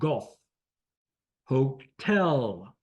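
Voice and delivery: Golf alone, then Hotel as ho-TELL; deliberate Golf, ho-TELL for radio practice; syllable stress demo. Shown as a demonstration. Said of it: "accent is on tell".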